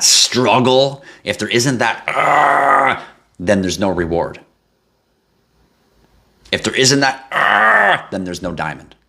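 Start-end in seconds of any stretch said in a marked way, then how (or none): 2.1-3.0 a short, higher strained grunt of effort; strained groan. Pretend. Acting out a struggle. 7.3-8.1 a much higher, short grunt of effort; strained groan. Pretend. Acting out a struggle again.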